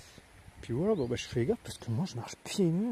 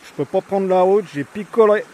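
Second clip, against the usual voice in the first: mock excuse; 6 semitones higher, 12 dB louder than usual. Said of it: "je sais ce que j'ai à dire".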